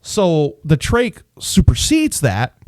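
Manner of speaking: ordinary talk, nothing marked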